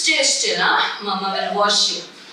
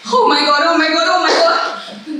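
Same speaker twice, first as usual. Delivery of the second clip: loud, high-pitched frantic cry; panic; 5 semitones higher, 7 dB louder than usual. Acted. Mother sprayed with multi-purpose paint, panicked cry. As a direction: gabble it loudly in horror.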